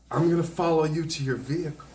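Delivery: high, loud mimicry of a threat; threatening stranger. Woman threatens to follow man to his car, high loud mimicry. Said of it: "then she goes".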